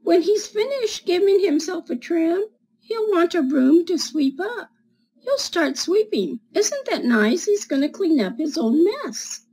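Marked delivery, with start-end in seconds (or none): 0.0-6.4 put-on reading to children. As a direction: read it like storytime for little kids. Reads picture book to children.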